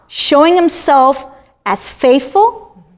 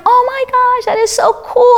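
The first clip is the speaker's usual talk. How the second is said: high, thrilled gush of wonder; excited awe at the bird nest; amazed delight; 10 semitones higher than usual. Retold or imitated. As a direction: marvel at it like a kid seeing it for the first time. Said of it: "and I'm like".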